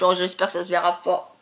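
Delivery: usual speaking voice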